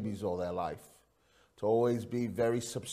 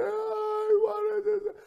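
very high, drawn-out mock wail; slow, squealing child's cry; crying young son; 5 dB louder, much slower than usual. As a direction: imitate a little boy bawling. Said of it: "Elijah, why are you crying?".

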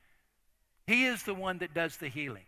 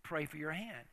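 quiet inner voice; 2 semitones lower, 8 dB quieter than usual. Retold or imitated. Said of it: "I hear this voice say".

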